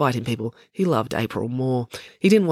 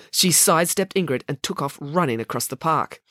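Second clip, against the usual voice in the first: ordinary delivery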